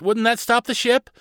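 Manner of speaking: put-on high-pitched child's question; hopeful girl captain. Carly presses Barnabas to stop the ship, high and hopeful. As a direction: ask it high, pressing the idea.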